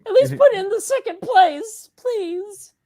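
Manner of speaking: put-on high whiny pleading boy; Shinji voice. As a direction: beg in a high whimper, laughing. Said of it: "you really do sound like Shinji".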